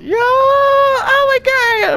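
high loud drawn-out shout of amazement; excited shout. Real, not acted. Gamer overwhelmed as the gnomes climb the step.

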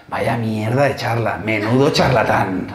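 slow, low mutter of contempt; hushed, disparaging aside to a neighbour; muttered whisper. Acted. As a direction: murmur the put-down under your breath, slowly. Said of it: "también me susurra".

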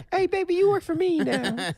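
high put-on pimp voice. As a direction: play a smooth-talking pimp. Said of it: "so it's a pimp".

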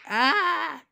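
short high scream. Pretend. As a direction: yelp in game panic. Scream as the attempt fails.

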